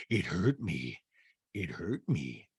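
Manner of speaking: soft, low, slightly hurt delivery; lightly voiced wounded castmate. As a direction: quietly imitate a hurt castmate. Recapper acts out Rinna.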